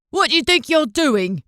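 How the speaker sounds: high, loud accusing challenge; angry demand. A narrator's reading. Fisherman confronting the thieving mother, angry. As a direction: catch her in the act.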